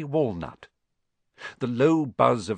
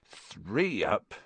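slow, softer counting to oneself; muttering; 3 semitones lower, 6 dB quieter, much slower than usual. A narrator's reading. Hagrid counting bricks in the wall, muttering. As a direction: count it out slowly under your breath. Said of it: "he muttered".

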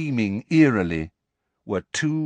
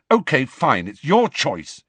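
curt; 6 semitones higher, 5 dB louder than usual. A narrator's reading. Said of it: "he said curtly".

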